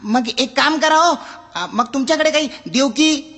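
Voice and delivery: high, whiny request; pleading customer. Acted. Customer begs the librarian, high and whiny. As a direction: wheedle for any book at all. Customer lists book titles.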